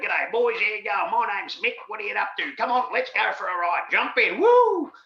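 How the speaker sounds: loud, high, hearty outback bloke; Queensland bush cowboy. Retold or imitated. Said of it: "he sounded like a cowboy from the bush in Queensland".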